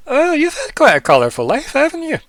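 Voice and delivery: very high, loud character voice; NPC in character. Acted. NPC remarks on the player's life, high teasing-question voice.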